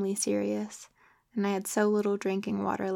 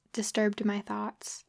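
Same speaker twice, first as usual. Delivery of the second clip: usual speaking voice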